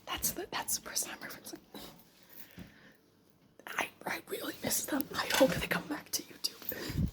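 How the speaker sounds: soft unvoiced whisper. Real, not acted. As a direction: whisper it under your breath. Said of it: "I can kinda hear you, what you're saying".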